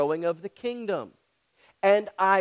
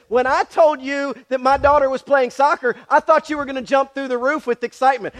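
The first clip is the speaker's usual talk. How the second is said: higher and louder, mock excited scolding; excited wife; 7 semitones higher, 8 dB louder than usual. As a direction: throw her cheerful protest right back at him. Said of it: "and she's like".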